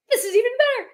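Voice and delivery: high, loud retold delight; thrilled squeal of approval; delighted reaction. Customer learning the artist drew the cat, delighted.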